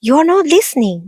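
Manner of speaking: high mouse squeak, impatient; squeaky scolding. A narrator's reading. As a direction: squeak at her to listen. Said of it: "Mouse Woman squeaked".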